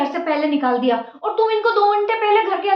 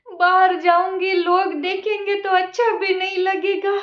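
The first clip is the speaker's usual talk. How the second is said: slow, weepy begging; begging through tears; tearful pleading. Acted. Old mother weeping and begging her daughter-in-law for mercy.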